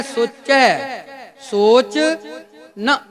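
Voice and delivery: "ਸੋਚੈ", "ਸੋਚ", "ਨਾ" each set apart by pauses; word-by-word pronunciation. Shown as a demonstration. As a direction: say "ਨਾ" on its own after a pause, with "ਸੋਚੈ" fully open. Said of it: "ਇਹ ਧਿਆਨ 'ਚ ਰੱਖਣਾ ਹੈ". ("ਸੋਚੈ ਸੋਚ ਨਾ" ਇੱਕ-ਇੱਕ ਸ਼ਬਦ ਵੱਖ ਕਰਕੇ)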